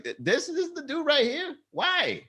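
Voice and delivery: high, pained disbelief; incredulous whine. Retold or imitated. Comic imagining meeting ex's new man, high pained disbelief. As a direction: wail where did I go wrong.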